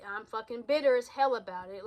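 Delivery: usual speaking voice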